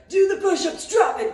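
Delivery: loud barked drill order; drill shout. Retold or imitated. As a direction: yell it like a drill instructor. Father yelling drill orders.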